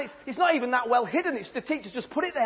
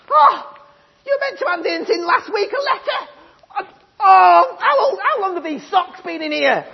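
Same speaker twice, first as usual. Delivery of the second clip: much higher, loud shrieking mum; scolding mum; 9 semitones higher, 9 dB louder than usual. Acted. Mum yelling at Jimmy coming home late, shrill and loud. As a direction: tear into him like a furious mother. Mum going through his bag.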